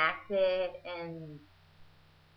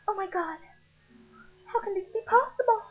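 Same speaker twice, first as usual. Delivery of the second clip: much higher, louder and fast; shocked Nessie; 12 semitones higher, 6 dB louder, faster than usual. Retold or imitated. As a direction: blurt it out in disbelief.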